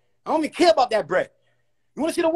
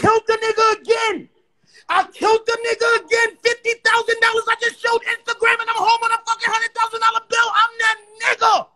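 victory shout, pitched way up; hyped boasting; 10 semitones higher than usual. Real, not acted. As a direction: crow over the win at the top of the voice. Hyped boast to live chat.

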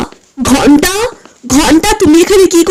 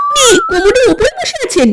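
shrill shocked gasp, much higher; 9 semitones higher than usual. Acted. Wife hearing the guest sits on the toilet, shocked. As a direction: recoil in disbelief, voice jumping up.

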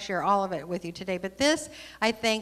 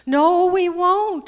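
slow, loud, much higher vow; earnest promise; 8 semitones higher, 10 dB louder, slower than usual. Acted. Little soul vowing to the friendly soul, loud and slow. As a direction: insist slowly and loudly. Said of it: "the little soul promised again".

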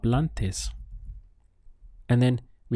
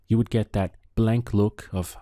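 usual speaking voice

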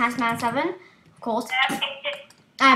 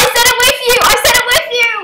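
high, loud gleeful shout; excited shout; 9 semitones higher, 9 dB louder, faster than usual. Real, not acted. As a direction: shout it out in delight. Jinx, saying the same number.

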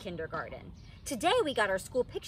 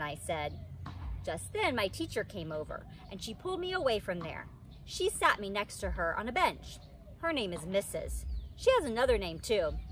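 plain reading voice

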